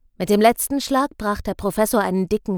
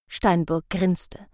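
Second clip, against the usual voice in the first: usual speaking voice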